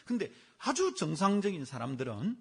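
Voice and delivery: ordinary delivery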